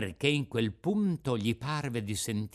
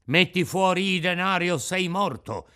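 menacing threat; 5 semitones higher, 5 dB louder than usual. Acted. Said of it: "disse l'assassino più alto".

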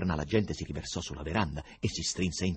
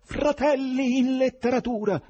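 high, loud, drawn-out rallying cry; impassioned high shout; shouted appeal; 8 dB louder than usual. A narrator's reading. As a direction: cry out slowly to a whole crowd. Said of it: "alzò il cero e gridò".

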